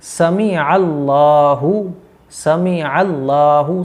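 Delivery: समी अल्लाहु very slow with a clear ऐन; slow, clear Sami'Allahu. Shown as a demonstration. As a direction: model समी with a careful ayn. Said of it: "अच्छे तरीके से याद रखें". (समी अल्लाहु धीरे, 'ऐन' साफ़)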